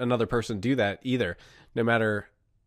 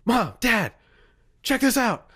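higher, urgent call to mom and dad; calling out to parents; 11 semitones higher than usual. Retold or imitated. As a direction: call the parents over, high and excited. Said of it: "So I'm like".

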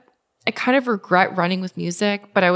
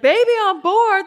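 high-pitched imitation of an excited crowd; retold crowd exclamation, pitched far up; excited onlookers; 13 semitones higher, 4 dB louder than usual. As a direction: squeal the shirt slogan back in surprise. Crowd exclaims on reading her shirt.

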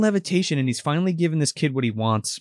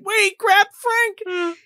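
much higher, startled voice; mock alarm; much slower than usual. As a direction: gasp his name in sudden panic. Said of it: "the kid's like".

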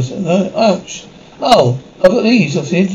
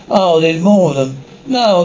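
normal talking voice

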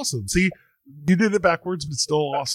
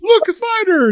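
high, loud playful cry of alarm; mock alarm; 6 dB louder than usual. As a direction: sound the alarm like a kid at a video game. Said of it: "and I'm like".

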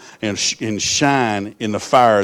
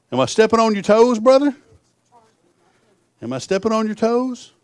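much higher, teasing question; 12 semitones higher, 4 dB louder than usual. Real, not acted. Preacher teases his congregation, high teasing question. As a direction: needle them playfully, way up high.

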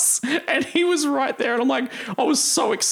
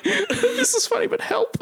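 laughing plea; 11 semitones higher than usual. Retold or imitated. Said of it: "I'm like".

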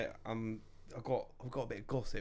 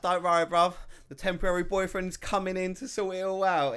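louder, a hint of a swaggering lad; cocky mock reply; 5 semitones higher, 8 dB louder than usual. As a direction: toss it off smugly, louder. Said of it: "I'm like".

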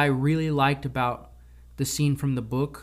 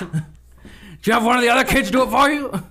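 higher, louder, fast mock shouting with a laugh in it; hammed-up furious headmaster, half laughing; angry Dumbledore; 8 semitones higher, 7 dB louder than usual. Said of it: "he grabbed them and said".

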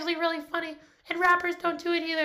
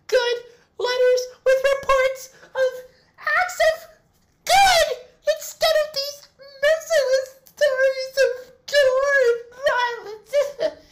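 loud, slow, drawn-out lament; overwrought wail; 4 semitones higher, 7 dB louder, slower than usual. Acted. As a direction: plead tearfully at full volume. Nutmeg laments violent letters.

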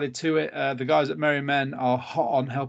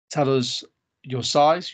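ordinary talk, nothing marked

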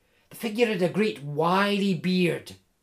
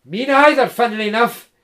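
big hearty Scots voice, louder and higher; jovial Scottish woodsman; 6 semitones higher, 10 dB louder, faster than usual. Acted. Tree surgeon teasing Jim in the dark woods, hearty Scots. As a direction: tease him like a cheerful giant.

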